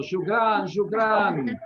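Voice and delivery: hollered greeting, playfully acted; mock shouting. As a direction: call it out as if running in from afar.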